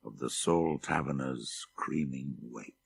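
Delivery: ordinary delivery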